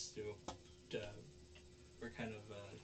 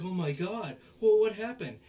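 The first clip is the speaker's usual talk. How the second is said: higher and louder, alarmed; shocked cry; 9 semitones higher, 12 dB louder than usual. Retold or imitated. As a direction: gasp it out in shock. Onizuka sees her fall.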